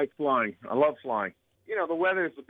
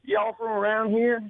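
higher-pitched Texan question; Texas accent; 8 semitones higher, 4 dB louder than usual. Shown as a demonstration. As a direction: play up a Texas twang. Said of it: "can you do a Texas?".

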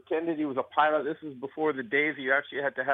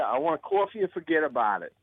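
higher-pitched New York wise guy; New York accent; 3 semitones higher than usual. Shown as a demonstration. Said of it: "can I have a New York".

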